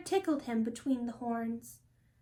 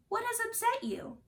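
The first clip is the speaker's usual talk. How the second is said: worried parents; 9 semitones higher than usual. A narrator's reading. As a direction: ask gently, full of concern. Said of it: "they asked, worried".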